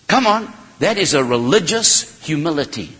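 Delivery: normal talking voice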